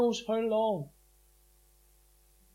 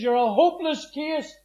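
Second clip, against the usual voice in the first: higher, louder exasperated mother; a mother shouting at her son, higher and louder; mother yelling; 6 semitones higher, 6 dB louder than usual. Retold or imitated. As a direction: throw it at him in despair. Mother scolding son in cells.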